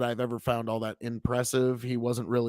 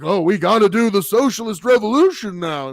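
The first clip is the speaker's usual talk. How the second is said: high, loud mocking impersonation of a zealot; mock his overexcited call to arms; loud, pitched-up parody of a fanatic; mocking true believer; 7 semitones higher, 9 dB louder than usual. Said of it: "now he's like".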